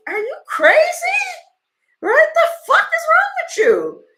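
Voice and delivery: high, loud shout of disbelief, imitating him; incredulous yell. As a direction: yell at her like she's lost her mind. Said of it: "And he was like".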